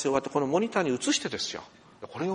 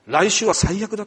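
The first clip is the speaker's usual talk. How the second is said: mock despair; 6 semitones higher, 11 dB louder than usual. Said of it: "となるんです".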